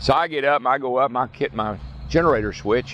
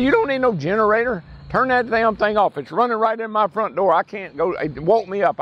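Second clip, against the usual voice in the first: raised, high-pitched mock rant; imitating angry Dale; 9 semitones higher than usual. Dale telling his neighbor the generator woke him, in a high mock rant. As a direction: complain like a grumpy man who was woken up.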